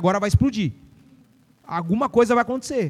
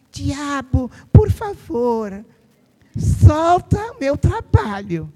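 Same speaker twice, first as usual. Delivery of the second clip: mock a meek believer begging the devil; timid pleading prayer; 3 semitones higher, much slower than usual. Mocking a meek believer begging the devil, slow and whiny. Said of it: "Aí fica assim".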